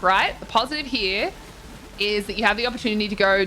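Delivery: usual speaking voice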